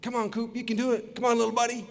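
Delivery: high, coaxing pet-talk voice; gentle encouragement. Retold or imitated. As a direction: coax a nervous little dog along. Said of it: "I give him a little encouragement".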